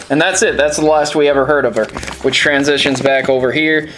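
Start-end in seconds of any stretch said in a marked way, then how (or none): none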